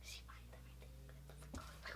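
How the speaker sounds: unvoiced whispered aside; whispering. Real, not acted. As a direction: whisper it to the camera. Aside to camera.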